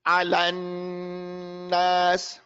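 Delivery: "Alan nas" very slow, with a long held n; repeat-after-me model. Shown as a demonstration. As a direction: chant "Alan nas", humming on the doubled n. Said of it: "You all are after me".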